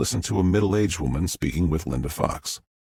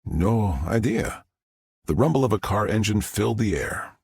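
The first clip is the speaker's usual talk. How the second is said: ordinary delivery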